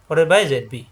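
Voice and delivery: usual speaking voice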